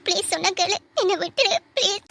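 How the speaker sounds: frightened, halting plea; desperate pleading. Acted. Woman refusing her captor's threat, desperate pleading. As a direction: plead as your voice gives way.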